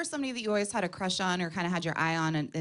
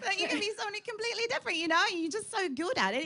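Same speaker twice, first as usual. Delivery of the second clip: quick, higher put-on British; British accent; 5 semitones higher, faster than usual. Shown as a demonstration. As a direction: gush in a mock-British accent. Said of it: "Can you do a British accent".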